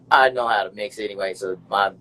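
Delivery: ordinary talk, nothing marked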